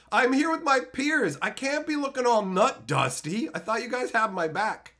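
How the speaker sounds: loud, high, hammed-up indignant yelling; mock outrage. Streamer scolding chat for not mentioning the nut dust, mock outraged. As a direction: rant like you've been betrayed, playfully.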